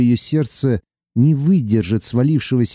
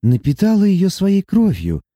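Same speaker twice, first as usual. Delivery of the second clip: put-on higher young woman's voice, tearful and earnest; young heroine; 8 semitones higher than usual. Sati confessing her love to Luka, tearful and earnest. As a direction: pour out love through tears. Sati's held voice.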